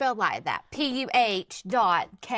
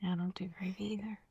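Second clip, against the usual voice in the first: whispering; 10 dB quieter than usual. Real, not acted. Whispered aside.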